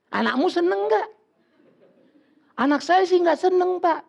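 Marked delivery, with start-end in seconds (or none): 2.6-4.0 higher, louder reply to the pastor; lightly voiced parent reporting the child's refusal; parent answering. (orang tua menjawab pendeta, suara lebih tinggi)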